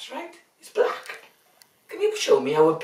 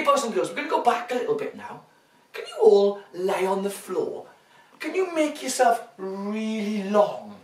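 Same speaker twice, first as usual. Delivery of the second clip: ordinary delivery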